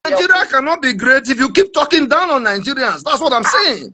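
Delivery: high-pitched, offended protest; real vexed objection. Real, not acted. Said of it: "they make me vex".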